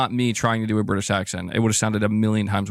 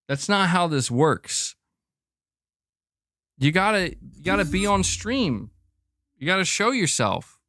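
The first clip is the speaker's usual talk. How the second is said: higher-pitched, drawn-out lecture to a pet; mock scolding; 6 semitones higher, slower than usual. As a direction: playfully tell off the cat in a high voice.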